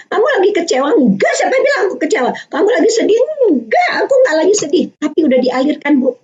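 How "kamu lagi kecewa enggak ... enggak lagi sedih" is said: higher, quick denials; a hint of a sulky child brushing off each guess; child denying. Parent and child guessing feelings.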